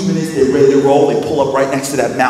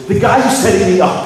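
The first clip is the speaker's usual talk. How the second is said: retold fury; 7 semitones higher, 3 dB louder than usual. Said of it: "he is breathing fire".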